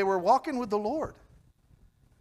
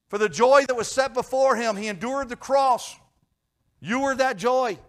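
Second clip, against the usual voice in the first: loud preaching; 7 semitones higher, 8 dB louder than usual. Acted. Preaching climax.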